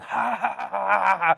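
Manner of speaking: high-pitched gloating fake laugh; put-on high cackle, imitating a gloater; mock laughter. Comic imitating a rival's gloating videos, mocking laugh. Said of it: "making videos where I'm like".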